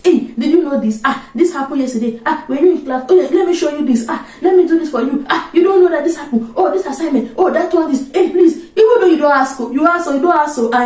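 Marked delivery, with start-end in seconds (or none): 0.0-8.6 higher, bubbly know-it-all chatter; eager chatter. Retold or imitated. Student gushing to classmates in first semester, eager and higher. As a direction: gush at classmates, offering help nonstop. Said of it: "if my classmates remember".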